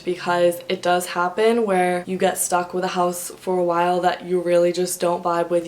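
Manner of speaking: ordinary delivery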